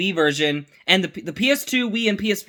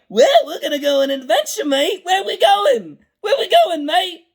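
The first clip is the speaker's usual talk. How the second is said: goofy Gregor voice; 11 semitones higher than usual. Retold or imitated. Mocking the newer goofy Gregor voice, much higher and hammed-up. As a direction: ham up the eager goofy clone. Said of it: "he doesn't sound like".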